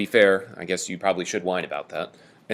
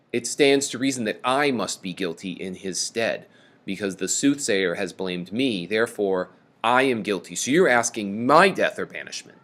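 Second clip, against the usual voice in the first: usual speaking voice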